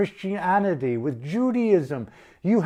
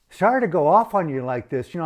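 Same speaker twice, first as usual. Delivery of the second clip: normal talking voice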